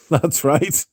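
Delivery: usual speaking voice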